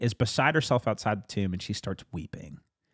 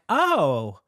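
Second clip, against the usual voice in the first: delighted realization; 7 semitones higher, 3 dB louder than usual. Retold or imitated. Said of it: "And I'm like".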